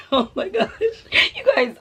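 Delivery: real laughter spilling into words; laughing. Real, not acted. Telling viewers the car story, laughing. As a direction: giggle through it, still amused.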